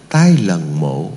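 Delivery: low, very slow repeat of the line. Acted. Monk repeating the poem line slowly. Second take, slower.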